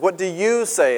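"you" punched as a challenge; emphatic YOU, the rest lighter; stress on YOU. Shown as a demonstration. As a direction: lean hard on the word "you". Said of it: "you" should be italicized there".

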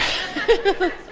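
high, real giggle; amused giggle. Real, not acted. Preacher amused by his nugget digression, giggling. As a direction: break into a helpless little laugh.

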